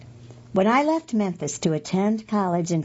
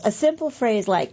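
ordinary talk

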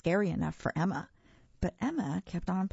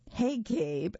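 mildly excited, pitched up; light cheer, higher; 5 semitones higher than usual. A narrator's reading. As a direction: call out with a touch of triumph. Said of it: "I cheered".

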